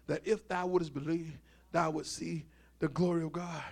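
low, hushed reading from the Bible; scripture reading. Acted. Preacher reads the Lazarus passage to close out, quiet scripture reading. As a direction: read the verses softly, dropping well down.

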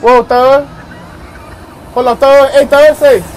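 loud, urgent calls to the operator; panicked shouts at the ride operator; scared shouting. Real, not acted. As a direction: yell for him to stop it.

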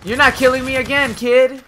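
high, loud exasperated yelling; real high-pitched rage at the game; frustrated gamer yell. Real, not acted. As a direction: yell in outrage at being killed. Reacting to repeated deaths in game.

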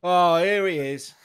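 loud, slow, drawn-out hello; delighted greeting. Real, not acted. Guard greets a returning favourite traveller, loud and delighted.

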